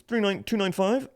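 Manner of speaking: high-pitched digits, rising at the end; querying clerk. Retold or imitated. Clerk repeating card numbers to customer, mock querying.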